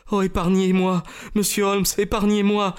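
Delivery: high-pitched, imploring lady's voice; desperate acted plea; pleading. A narrator's reading. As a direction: beg for mercy, pitched well up. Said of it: "supplia-t-elle".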